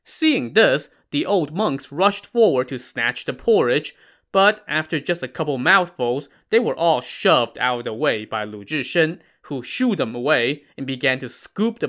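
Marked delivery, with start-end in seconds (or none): none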